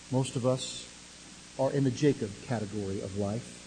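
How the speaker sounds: ordinary talk, nothing marked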